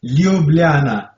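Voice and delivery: "Ljubljana" said slowly, each syllable drawn out; slow pronunciation demo. Shown as a demonstration. Dentist teaching American listeners his city's name, slowly. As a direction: stretch "Ljubljana" out slowly for learners. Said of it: "say it again slowly".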